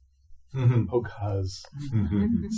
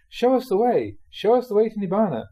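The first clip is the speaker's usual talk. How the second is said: higher, louder mock plea to be shown the way; mock pleading; 10 semitones higher, 6 dB louder than usual. Teacher mimics lazy students begging the Buddha, mock pleading. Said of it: "so we say".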